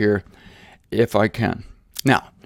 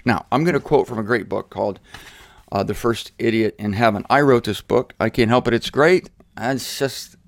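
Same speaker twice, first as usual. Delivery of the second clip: usual speaking voice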